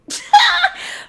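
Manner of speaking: loud, very high-pitched cackle; sudden high squeal of laughter; shrieking laugh. Real, not acted. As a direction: burst out in a piercing laugh. Host's outburst after retold insult.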